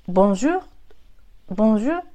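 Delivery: ボンジュール in two short syllables, end not stretched; short bonjour. Shown as a demonstration. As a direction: clip ボンジュール short, no long final. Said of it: "最後伸ばさないですね". (最後を伸ばさない短いボンジュール)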